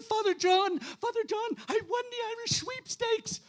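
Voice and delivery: high, breathless-with-news storytelling voice; excited Irish woman. Acted. Mother McCree telling the priest her big news, excited high voice. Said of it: "She was so excited".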